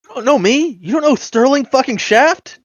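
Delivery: high, loud, offended outburst; incredulous rise, wounded self-importance; indignant disbelief. Acted. Prisoner affronted that the creature hasn't heard of him, indignant. Player acts offended by stranger's question.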